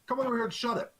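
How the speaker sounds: high mocking challenge to the guard; defiant taunt. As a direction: goad him into a fight.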